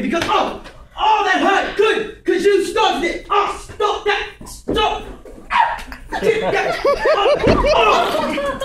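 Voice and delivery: high-pitched, shouted struggle; outraged comic shouting; farcical fight with himself. Acted. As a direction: yell at yourself mid-scuffle in short bursts.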